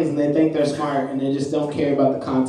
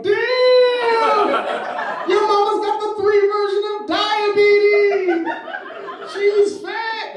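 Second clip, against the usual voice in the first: very high-pitched gleeful outburst; inner comedian; much slower than usual. Retold or imitated. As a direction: let loose a long high shriek of mock amazement. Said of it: "My inner comedian's like".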